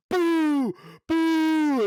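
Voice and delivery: high, loud jeering boo; a mock crowd booing Musk, high and loud. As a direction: jeer like an angry crowd. Crowd booing Elon in imagined film.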